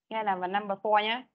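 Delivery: ordinary delivery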